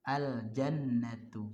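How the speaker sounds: Al jannatu slow, the lam sounded clearly. Shown as a demonstration. Teacher models Al jannatu for students, slow and clear. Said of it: "alif lam qamariyah".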